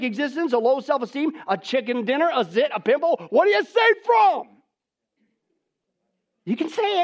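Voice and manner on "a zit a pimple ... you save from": normal talking voice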